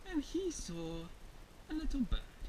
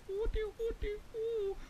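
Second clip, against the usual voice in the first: high, thin cooing; tentative bird call; 10 semitones higher than usual. Acted. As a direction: try out a little bird hoot. Bear attempts bird language.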